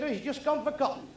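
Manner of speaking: mill worker. Retold or imitated. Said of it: "He said".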